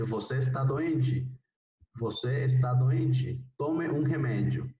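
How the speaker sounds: "doente" drawn out slowly as doenchi; slow Portuguese reading. Shown as a demonstration. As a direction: read the example slowly and clearly for students. (lectura lenta en portugués)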